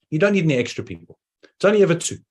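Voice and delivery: usual speaking voice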